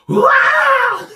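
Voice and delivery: very high, loud panicked scream; piercing re-enacted yelp of terror; howl. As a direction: let out a startled shrieking howl. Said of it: "I let out the only howl".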